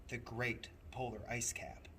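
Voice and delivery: ordinary delivery, nothing marked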